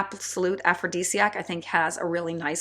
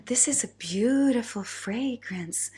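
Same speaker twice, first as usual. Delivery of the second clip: slow, sultry put-on voice; sexy voice; 4 semitones higher, slower than usual. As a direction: say it slowly and seductively. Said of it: "someone who talks a little bit like this".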